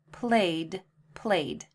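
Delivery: played as one syllable; repeat played. Shown as a demonstration. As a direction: say played for careful listening. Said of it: "Let's listen again".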